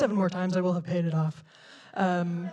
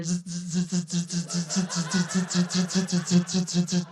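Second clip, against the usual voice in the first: repeated monotone zapping buzzes; a steady string of mouthed electrical buzzes; electric fly swatter buzz; 2 semitones lower than usual. Retold or imitated. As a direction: imitate an electric bug zapper going off. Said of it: "like there's an electric fly swatter".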